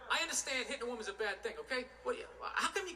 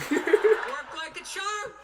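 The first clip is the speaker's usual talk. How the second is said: slow, louder, laughing through it; laughing gloat; 5 semitones higher, 10 dB louder, much slower than usual. Real, not acted. Gloating to the audience that the plan worked, laughing. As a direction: savor it with a laugh.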